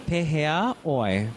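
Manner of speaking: "Pehea oi" slowly, syllable by syllable; slow repeat. Shown as a demonstration. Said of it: "say it one more time for me".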